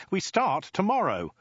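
very high, emphatic insistence; exasperated and pitched right up. Acted. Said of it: "threw up his arms in exasperation".

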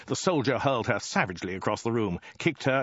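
usual speaking voice

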